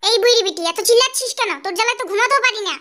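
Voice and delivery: very loud, annoyed yelling back; angry shout. Acted. Grandson woken up, yelling angrily back at grandmother. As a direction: blast her with loud irritation.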